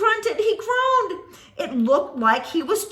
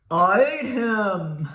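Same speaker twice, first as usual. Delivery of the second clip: monster voice; 6 semitones lower, 3 dB louder, slower than usual. Acted.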